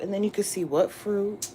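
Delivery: ordinary delivery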